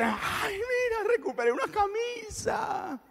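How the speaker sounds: high, excited near-squeal; thrilled squeal. Acted. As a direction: squeal with joy over a found shirt. Thrilled at recovering a shirt.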